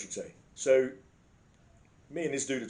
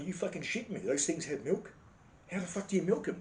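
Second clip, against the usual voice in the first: higher-pitched feigned amazement; put-on incredulity, pitched up; mock disbelief; 5 semitones higher, 4 dB quieter than usual. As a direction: act as if you can't believe it. Feigning ignorance of milking cows.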